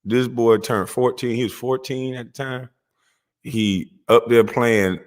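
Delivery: normal talking voice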